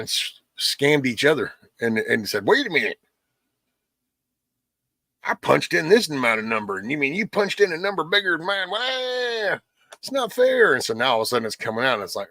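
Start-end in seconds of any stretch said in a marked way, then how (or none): 2.5-10.7 high, rising mock outrage, climbing to a squeal; hammed-up sore loser, pitch climbing higher and higher; mock whiny complaint. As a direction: play a petty politician crying foul. Mocking Democrats arguing over numbers.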